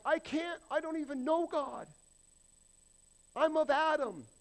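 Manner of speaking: high, loud, pleading voice; whiny unbeliever. Pretend.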